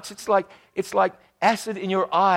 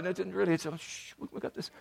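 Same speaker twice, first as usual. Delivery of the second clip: much softer, fading into a whisper; hushing whisper; 13 dB quieter, faster than usual. Retold or imitated. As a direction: hush her quietly so the husband won't hear. Hushing the wife in front of her husband.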